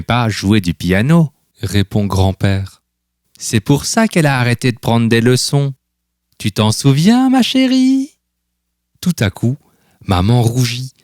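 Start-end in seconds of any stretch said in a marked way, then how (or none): 6.4-8.1 teasing grandfather. A narrator's reading. Grandfather teasing his daughter.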